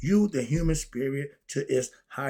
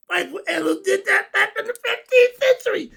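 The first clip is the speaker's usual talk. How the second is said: excited laughing; 7 dB louder than usual. Real, not acted. Excited outburst about painting.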